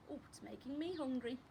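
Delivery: usual speaking voice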